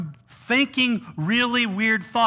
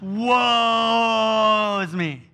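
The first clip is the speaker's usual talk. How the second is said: hugely drawn-out theatrical wail; mock wailing; much slower than usual. Preacher mimics the Israelites wailing about it, exaggerated moan. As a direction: stretch the first word into a long hammed-up lament. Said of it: "grumbling and just wailing about".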